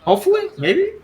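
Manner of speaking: high, loud, eager guild recruiter; eager Tukal. Acted. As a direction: pitch it up, hoping he joins. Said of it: "Tukal turns like".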